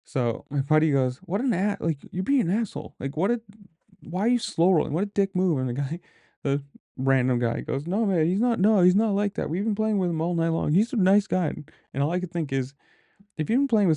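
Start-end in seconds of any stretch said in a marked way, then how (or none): 1.2-5.6 higher-pitched imitation of an affronted friend; retold indignation. Retelling buddy's indignant quarrel. 7.9-11.5 higher-pitched imitation of a bystander objecting; retold defensive protest. Retelling bystander defending the player.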